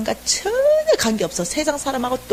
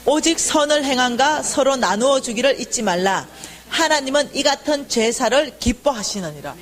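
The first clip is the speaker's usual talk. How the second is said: slow, measured reading aloud; scripture reading; 4 semitones higher than usual. Real, not acted. Preacher leading the congregation in a verse, slow reading. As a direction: read the verse out deliberately and clearly.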